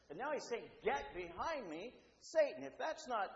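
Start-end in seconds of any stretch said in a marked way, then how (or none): 0.8-2.6 slow command rising to a loud, high last word; strong rebuke. Retold or imitated. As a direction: draw out the order, then hit the name hard. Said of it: "Jesus rebukes him very strongly".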